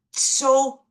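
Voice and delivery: so shot up high and loud; stressed so. Retold or imitated. Telling her story, she bursts out on so in anger. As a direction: punch the word so with anger. Angry outburst about the world.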